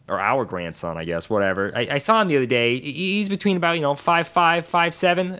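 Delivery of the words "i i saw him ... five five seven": wife's voice. Retold or imitated. Wife's answer voiced in story.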